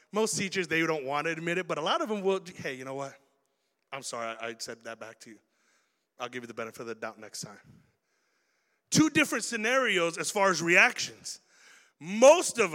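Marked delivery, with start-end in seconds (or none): 2.6-7.6 much softer and lower, humble; soft teacher apology. Retold or imitated. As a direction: admit the mistake quietly.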